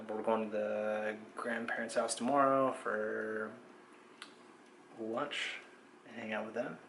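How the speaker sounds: hushed update to viewers; quiet vlog talk. Real, not acted. Quiet late-night vlog update.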